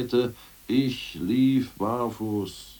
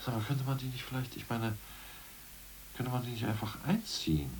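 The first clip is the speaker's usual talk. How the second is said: conspiratorial hush; 12 dB quieter than usual. Acted. Hushed suggestion of a bold idea.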